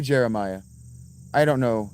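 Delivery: normal talking voice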